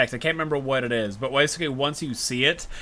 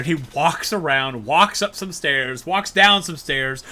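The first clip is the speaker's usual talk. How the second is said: usual speaking voice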